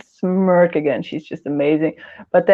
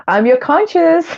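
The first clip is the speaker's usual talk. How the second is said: playful conscience voice; 9 semitones higher than usual. Pretend. Playing the conscience voice.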